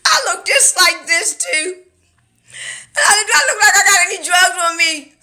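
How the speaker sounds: cracking up mid-sentence, voice high and loud; laughing through the words in disbelief. Real, not acted. Laughing while telling viewers about the stop.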